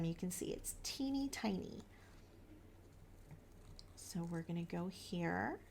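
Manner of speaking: words on a held breath; holding breath. Real, not acted. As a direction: talk while holding your breath. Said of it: "I'm holding my breath while I'm cutting".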